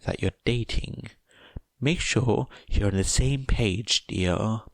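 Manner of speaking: put-on kindly steer the girl; motherly advice. Mother gently advising Toga to ask Izuku.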